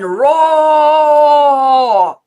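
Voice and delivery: very loud, high, long-held "roar"; lion's roar. Shown as a demonstration. Coach leads the lion's breath exhale, full-force roar. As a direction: bellow it out on one full exhale. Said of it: "I'm gonna say roar".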